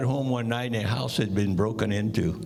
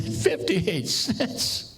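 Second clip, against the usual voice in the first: incredulous; 12 semitones higher, much slower than usual. Acted. Muggers shocked at tiny haul.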